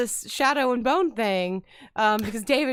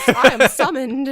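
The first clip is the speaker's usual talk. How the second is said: mock grand summons; 3 semitones higher, 9 dB louder than usual. Joking voice for the arriving author.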